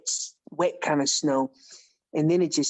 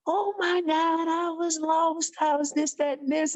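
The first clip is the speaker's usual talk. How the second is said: a retold crying rush, pitched way up; imitating tearful; 12 semitones higher than usual. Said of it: "she busts out in tears".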